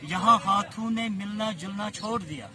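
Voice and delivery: measured mushaira verse recital; poetry recitation. Acted. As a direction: recite the couplet with phrase pauses.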